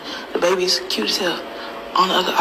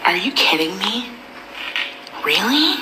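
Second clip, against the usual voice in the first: higher-pitched imitation of appalled onlookers; put-on disbelief, pitched up; mock outraged critics; 4 semitones higher than usual. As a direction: mimic scandalized questioning, rising high on "Really". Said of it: "the rest of y'all are like".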